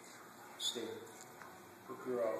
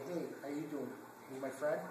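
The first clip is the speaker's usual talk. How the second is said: mock friendly greeting; 6 dB quieter than usual. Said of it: "than by going".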